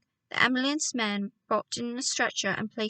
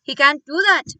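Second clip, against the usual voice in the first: louder, higher indignant outburst; furious; 5 semitones higher, 11 dB louder than usual. Acted. Doctor raging at father about Hazel. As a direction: spit it out in outrage. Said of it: "jumping up and down with fury".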